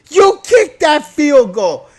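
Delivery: loud, slow, high outburst; shouting. Real, not acted. Fan ranting at the coach, shouting. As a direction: yell it like a furious fan. Heated rant about coach decision.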